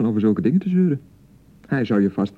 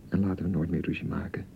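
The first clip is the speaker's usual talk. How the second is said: tender; 8 semitones lower, 11 dB quieter than usual. Acted. Husband making peace after quarrel.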